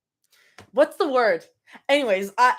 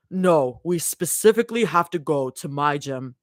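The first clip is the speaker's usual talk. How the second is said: Abby voice; 7 semitones lower than usual. Acted. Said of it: "my Abby voice".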